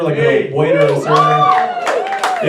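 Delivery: high, slow shout to the crowd; calling out. Real, not acted. Comic asking the crowd about servers, a high slow shout. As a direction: call it out across the room. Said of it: "calling out to audience".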